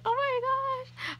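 high, slow, emotional exclamation; overwhelmed gasp. Retold or imitated. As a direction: gasp as if about to cry. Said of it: "and I was like".

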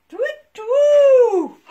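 bright hooting doo-doo; playful fanfare; loud high sound effect. Acted. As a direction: trumpet it loud.

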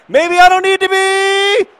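very high, loud and slowed; near-shout of excitement. Real, not acted. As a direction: peak of hope before the goal. Repeated as the shot goes in.

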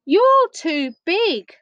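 high, slow protest; dismayed Eddie. A narrator's reading. Storyteller voices Eddie to the oversized teddy, dismayed. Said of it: "he said".

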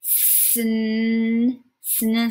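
slow sound-by-sound sn blend. Shown as a demonstration. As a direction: stretch out the sn blend. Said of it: "Let's sound it out too".

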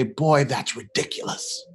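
slow, restrained dismissal; emphatic dismissal, held back. Retold or imitated. Dad unable to wave off his son's fear, subdued dismissal. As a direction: say it slowly, without the force. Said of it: "I couldn't emphatically look him in the face and say".

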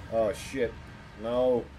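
ordinary delivery

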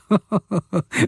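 high, mocking cackle; villain's laugh. A narrator's reading. Kidnapper scoffing at the plea, mocking laugh. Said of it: "lachte die metallische Stimme".

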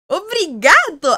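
loud, high, slow Portuguese "¡Obrigado!"; mock Portuguese accent. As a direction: call out Portuguese thanks to a Brazilian boyfriend, hammed up. Mocking Brazilian boyfriend, Portuguese thanks.

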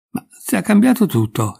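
high, mildly agitated protest; a touch of wriggling impatience; exasperated exclamation. A narrator's reading. Colli struggles free of his friend, mildly exasperated. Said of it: "esclamò il Colli divincolandosi".

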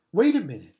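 high, loud, impatient retort; Lucy, cross. A narrator's reading. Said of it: "said Lucy crossly".

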